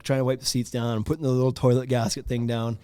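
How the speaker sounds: ordinary talk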